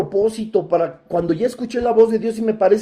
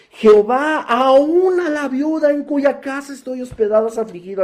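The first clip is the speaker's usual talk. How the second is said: súplica aguda y alargada, alzando la voz; clamor a gran voz; 5 semitones higher than usual. Acted. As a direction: clama a Dios con la voz en alto. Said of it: "grita a gran voz, con voz alta". (clamor en voz alta)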